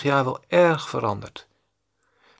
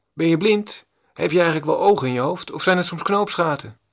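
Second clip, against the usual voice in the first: higher, louder scolding yell; mock angry shout; 4 semitones higher, 4 dB louder than usual. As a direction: bark it like an insulted stranger. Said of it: "die persoon begint te schreeuwen".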